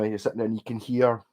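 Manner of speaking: usual speaking voice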